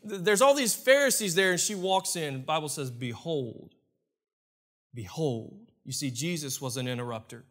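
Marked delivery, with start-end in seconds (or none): 2.9-5.7 soft, low, drawn-out behold; hushed behold. Acted. Preacher hushing the congregation, intoning behold. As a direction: let the word hang in a hush.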